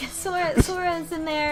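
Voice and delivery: ordinary talk, nothing marked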